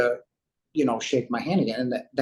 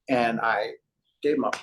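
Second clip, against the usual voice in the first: ordinary talk, nothing marked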